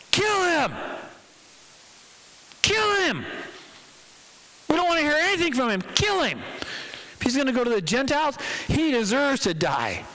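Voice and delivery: high-pitched angry mob cries; enraged mob. Acted. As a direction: cry out like a riled-up crowd, very high.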